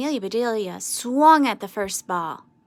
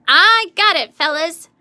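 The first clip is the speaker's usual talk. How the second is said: higher and much louder triumphant call; proud cry; 7 semitones higher, 11 dB louder than usual. A narrator's reading. Amelia Bedelia to the team after her catch, proud shout. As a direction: crow it out proudly.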